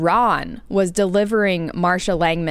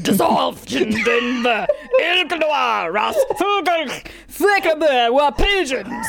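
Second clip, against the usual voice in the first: slow, higher, shouted mock Russian accent; 8 semitones higher, slower than usual. Reading keyboard-mash notes to friend, mock Russian accent. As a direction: ham up a guttural Russian accent. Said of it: "That was a little more Russian".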